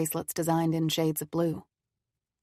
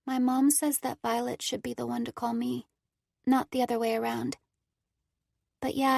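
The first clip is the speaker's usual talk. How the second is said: put-on sad young girl; 7 semitones higher than usual. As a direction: speak sadly, like a lonely child. Cora speaks of loneliness.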